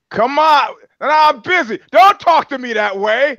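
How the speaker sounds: high-pitched mock indignation; exasperated. Fight boss brushing off a caller on the phone, exasperated. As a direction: raise pitch sharply in put-on annoyance.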